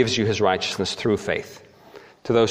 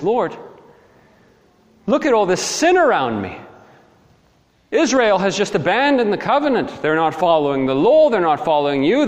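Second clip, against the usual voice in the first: high, pleading prophet's complaint; raised-pitch, exasperated appeal; crying out to God; 10 semitones higher, 4 dB louder than usual. Acted. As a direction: protest upward to God in frustration. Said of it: "he cries out to God".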